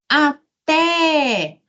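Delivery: "Até" high, with a long held TÉ; calling the word. Shown as a demonstration. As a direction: call out "Até", stretching the last syllable. Teacher calls word to show stress.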